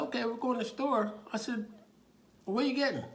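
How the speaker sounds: high, humoring reply; talking to a child. Retold or imitated. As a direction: play along with a little kid. Officer humoring a small child.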